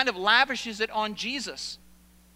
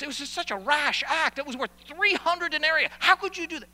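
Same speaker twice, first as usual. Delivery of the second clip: indignant outburst, higher and louder; mad; 5 semitones higher, 3 dB louder than usual. Acted. Onlookers scold Mary over the costly ointment, indignant. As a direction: build to an outraged, high-pitched demand. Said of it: "They were mad".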